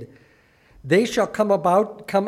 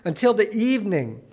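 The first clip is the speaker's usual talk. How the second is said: raised, earnest reading of the passage; scripture reading; 4 semitones higher, 4 dB louder than usual. Acted. Preacher reads Joshua's lament to the church, earnest reading. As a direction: read the lament to the congregation with weight.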